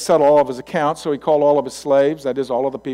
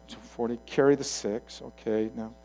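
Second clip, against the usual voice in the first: puzzled calculating; 3 semitones lower, 8 dB quieter than usual. Acted. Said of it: "I can just imagine some of the disciples".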